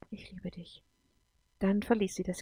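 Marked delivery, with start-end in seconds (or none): 0.1-0.8 barely audible whisper; very soft, fading near-whisper; hushed farewell. Acted. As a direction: breathe out a last goodbye. Last words as they part.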